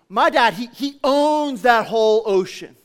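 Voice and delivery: put-on loud, high boast as the fed-up girl; acted one-upping proclamation; triumphant comeback. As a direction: top her claim, stretching the words proudly.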